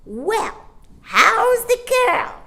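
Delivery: high, loud, slow cheeky bird; cheeky starling. A narrator's reading. Starling teasing Mary Poppins, cheeky and high. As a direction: tease her with a chirpy question. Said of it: "he inquired cheekily".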